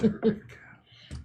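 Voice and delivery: mock warning. Joking warning.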